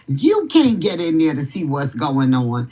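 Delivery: ordinary talk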